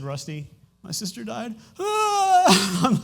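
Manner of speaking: crying. Real, not acted. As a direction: voice breaking into real tears. Retelling sister's death, tearful.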